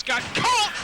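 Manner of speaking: sudden loud call; excited shout. Real, not acted. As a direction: yell it as the move lands. Surprise move in match.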